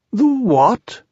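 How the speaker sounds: high, puzzled bear question; high storybook bear; startled Pooh. A narrator's reading. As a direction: jump up in pitch, baffled.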